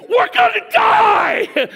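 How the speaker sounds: high, loud, stretched-out mock scream; panicked shout. Disciple waking Jesus in the storm, panicked shout. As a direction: yell it out in hammed-up terror.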